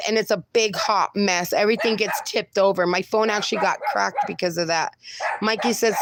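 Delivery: ordinary talk, nothing marked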